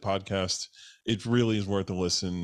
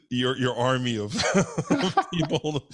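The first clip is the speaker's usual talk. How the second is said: laughing; 4 semitones higher, 3 dB louder than usual. Real, not acted. Laughing while describing listener army.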